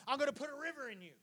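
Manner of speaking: suddenly soft, high and quick; quick, very soft aside; hushed promise. Acted. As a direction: drop to a confiding hush. Sudden hush ending the acted declaration.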